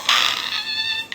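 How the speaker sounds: put-on a sudden, very high yelp like a startled toddler; imitated child's yelp. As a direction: squeal the child's protest. Child cries at eye drops.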